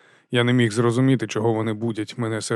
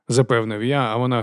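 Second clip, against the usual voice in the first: ordinary delivery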